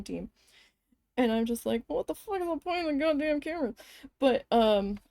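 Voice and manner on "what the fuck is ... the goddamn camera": high, quick exasperated outburst. Retold or imitated. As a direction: fire it off in disbelief, higher and faster. Acted exasperated reaction to cameras.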